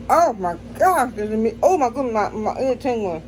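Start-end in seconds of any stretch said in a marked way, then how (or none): none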